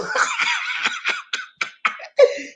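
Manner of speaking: high unvoiced burst of laughter; big laugh. Real, not acted. Host cracking up after singing to chat.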